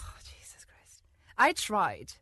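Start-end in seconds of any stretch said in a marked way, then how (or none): 0.0-1.0 unvoiced exasperated whisper. Real, not acted. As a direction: breathe it out under your breath. Reacts to Harry Potter fanfic.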